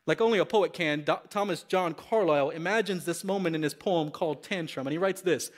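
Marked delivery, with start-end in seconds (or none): none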